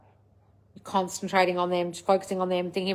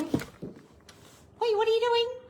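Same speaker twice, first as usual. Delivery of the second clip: much higher breathy pet voice, softer; talking to the dog; 14 semitones higher, 4 dB quieter, much slower than usual. Real, not acted. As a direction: coo at the dog getting in the wires.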